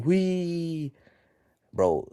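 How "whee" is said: high, drawn-out little cheer; hammed-up group celebration in a high voice; mock celebrating.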